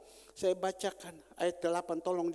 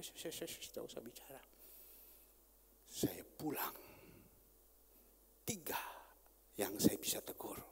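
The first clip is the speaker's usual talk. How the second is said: hushed aside; 6 semitones lower, 8 dB quieter than usual. Real, not acted. Pastor hushed.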